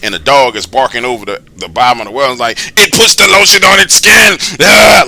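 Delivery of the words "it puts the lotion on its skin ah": high, loud psycho shout; creepy movie-killer voice. Retold or imitated. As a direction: shout it like a deranged film killer. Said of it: "that's the type of fucking voice this dude's got".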